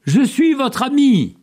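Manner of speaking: high, friendly call; appel amical. A narrator's reading. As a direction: call it out far across the valley. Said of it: "Et il ajouta". (lancer un appel amical, très haut)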